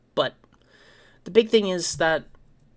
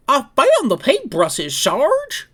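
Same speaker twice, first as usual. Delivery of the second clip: high, loud, proud report; high loud dopey recruit reporting to his sergeant on the phone; goofy Thomas voice; 9 semitones higher, 6 dB louder, slower than usual. Retold or imitated. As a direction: announce it like a clueless private who did great.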